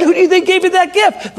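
high, pressing pastor's question; emphatic questioning. Retold or imitated. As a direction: press the point, pitch up high.

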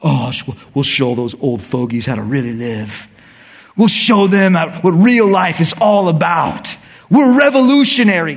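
the preacher mocks a boastful new generation, loud and cocky; mocking young rebel. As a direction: sneer like a know-it-all teenager. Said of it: "every new generation thinks".

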